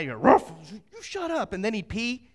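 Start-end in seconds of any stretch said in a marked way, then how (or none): 0.2-0.5 imitated bark. Preacher acting his sister-in-law's dog at night, imitated bark. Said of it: "he'd bark at me". 0.7-1.5 rude mock outburst. Rude dog sounding off at night, rude mock outburst. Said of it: "He'd be rude to me".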